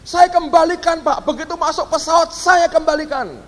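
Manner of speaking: high-pitched, imitated pushy plea; insistent pleading. Passenger presses the pastor for his boarding pass, imitated insistent pleading. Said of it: "didesek terus".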